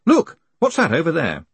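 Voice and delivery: high-pitched distracting cry. A narrator's reading. Conman's cry to distract before running away, urgent. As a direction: cry out as if pointing something out. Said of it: "a cry of".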